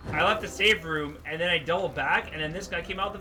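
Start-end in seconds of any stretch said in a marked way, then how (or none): none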